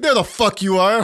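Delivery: high, loud mock confrontation; loud boss. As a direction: boom it out on spotting your target. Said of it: "and he's loud".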